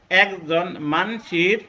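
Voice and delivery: slow Jessore-Khulna dialect demo. Shown as a demonstration. দেখানো খুলনার আঞ্চলিক উচ্চারণ. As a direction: say it as a Khulna local would, slowly. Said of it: "এটা একটি আঞ্চলিক ভাষা বলছি".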